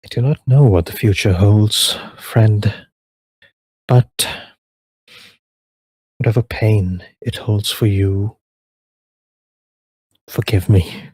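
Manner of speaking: put-on solemn elven lady speaking to an old friend; elf woman's farewell. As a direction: give it a grave, regal calm. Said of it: "she turns to him and goes".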